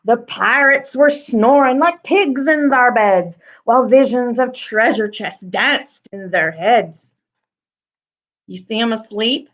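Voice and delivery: lively rhyming read-aloud; story narration. Acted. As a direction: read it with a bouncy rhythm to children. Reads the picture book aloud.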